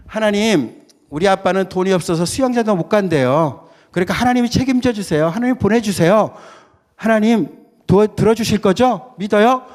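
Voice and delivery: put-on high, loud, excited child praying; eager child's prayer. Little boy praying to God for the swimming pool, eager and loud. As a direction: pray with childish bold faith.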